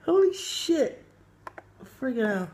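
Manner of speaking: high, loud shocked laughing; disbelieving laughing outburst. Real, not acted. Reacting to a shocking video.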